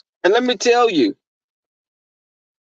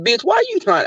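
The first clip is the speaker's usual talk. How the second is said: incredulous; 11 semitones higher, faster than usual. Retold or imitated. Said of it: "and I said".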